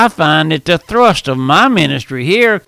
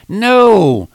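ordinary delivery

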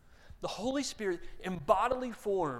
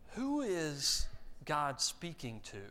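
usual speaking voice

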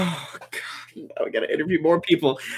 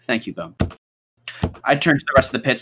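ordinary talk

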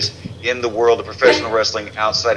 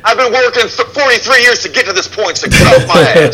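higher, louder huffy complaint; mock indignant; 13 semitones higher, 7 dB louder than usual. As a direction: play wounded pride, loud.

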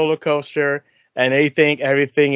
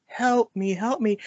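softer breathy helpless plea; 5 semitones higher, 5 dB quieter, slower than usual. Retold or imitated. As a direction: sigh it out like a damsel. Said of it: "that very soft, breathy voice".

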